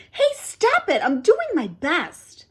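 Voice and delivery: high, sharp yelp; dive-bombed by the fly, yelping; alarmed shout. Acted. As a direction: fend off the attacker. Fly dive-bombing him.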